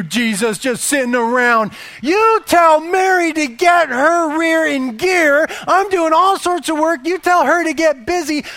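loud, high, exasperated rant; yelling at God. Acted. Martha yells at Jesus about lazy Mary, loud and high. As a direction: demand he fix it, nearly shouting. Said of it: "she began to yell at the God".

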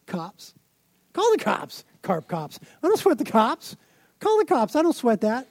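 high, mocking brush-off; cocky teen. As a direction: toss it off like a smug kid. Teen self defies the school office.

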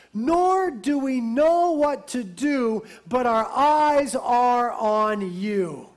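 slow, loud, drawn-out plea to God; impassioned scripture reading. Acted. Preacher reading the king's prayer to the congregation, slow and impassioned. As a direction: preach the verse out with weighty, stretched words. Reads the prayer at full force.

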